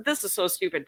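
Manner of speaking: ordinary talk, nothing marked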